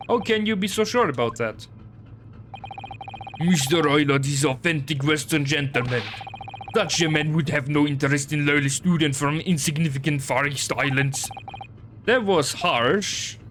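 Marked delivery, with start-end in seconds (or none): 3.4-11.3 gruff Russian sailor, proud; broken English with a heavy Russian accent. Acted.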